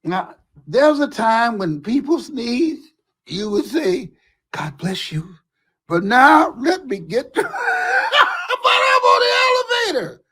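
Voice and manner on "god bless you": soft whispered blessing; hushed, polite "God bless you". Shown as a demonstration. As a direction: breathe the words.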